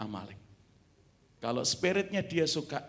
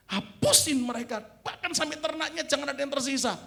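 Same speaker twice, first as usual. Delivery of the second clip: stern command; 11 semitones higher, 4 dB louder than usual. Acted. Preacher voicing God's command.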